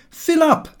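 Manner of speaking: high, loud call to refill; toasting call. Acted. Lupin calling the family to fill glasses, high and loud.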